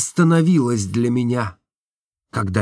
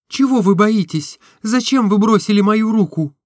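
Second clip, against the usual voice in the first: put-on higher, lighter girlish voice for Nastenka; Nastenka's voice; 11 semitones higher, 4 dB louder than usual. A narrator's reading. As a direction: voice the young woman, tender and open. (голос Настеньки, выше и легче)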